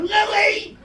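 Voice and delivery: high, loud mock scream; screaming a name. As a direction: yell it like What About Bob. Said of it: "When he kept screaming".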